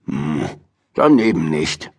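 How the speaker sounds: low sulky grumble; lightly voiced grumbling old man. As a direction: mutter it off, a bit miffed. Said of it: "murrte Mister Morrison".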